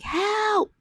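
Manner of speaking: high, thin cry of help; calling out while drowning; mock cry for help. Retold drowning woman calls for help, high and thin. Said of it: "and I'm like".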